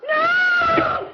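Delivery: high, loud last shriek; terrified scream. Acted. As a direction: scream for her life. Wife about to be killed.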